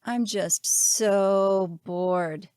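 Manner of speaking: "so" and "bored" leaned on, drawn out very slowly; stress on so and bored. A narrator's reading. Caesar complaining of boredom, very slow stress on "so" and "bored". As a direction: stretch out "so" and "bored" heavily. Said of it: "with the emphasis on so and bored".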